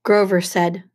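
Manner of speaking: ordinary delivery, nothing marked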